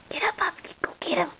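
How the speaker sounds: urging whisper to the puppy. Real, not acted. Owner urging puppy on, whispered.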